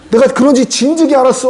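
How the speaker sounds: high-pitched mock outburst at a son; angry father. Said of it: "그러면서 막 두들겨 패요".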